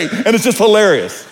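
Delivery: ordinary delivery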